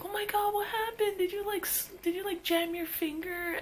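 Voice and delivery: put-on teen girl asking the crying kid what happened; concerned teen girl. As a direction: fuss over the crying kid like a big sister. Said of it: "she's like".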